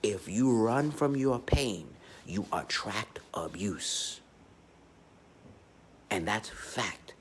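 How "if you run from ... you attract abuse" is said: drawn-out emphatic restatement; slow, weighty repeat. Shown as a demonstration. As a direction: say it again slowly so it lands. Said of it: "Let me re-, repeat that shit again".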